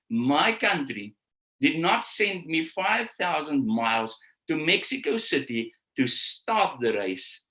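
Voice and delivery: slow, deliberate quote; slow and solemn retelling; said very slowly. Shown as a demonstration. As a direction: weigh every word of it. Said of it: "I'm gonna say this very slowly".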